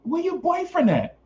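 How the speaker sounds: high, exasperated mock question; exasperated disbelief. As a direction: ask it sky-high, fed up with her. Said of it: "I'm like".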